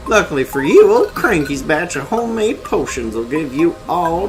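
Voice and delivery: put-on high, cranky old crazy voice; crazy old man voice. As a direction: rant like a mad old ape. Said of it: "See if I can do a crazy voice".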